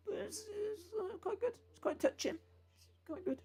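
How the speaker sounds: tiny, high, teary squeak; quiet high voice as if holding back tears; mock choked up. Mock choked-up verdict on touching film.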